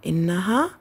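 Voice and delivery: ordinary talk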